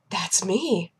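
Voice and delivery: gasp, then soft whisper; gasping awe. Retold or imitated. Woman hears her number called, awed whisper. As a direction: breathe it out in hushed mock amazement. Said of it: "she got up and she goes".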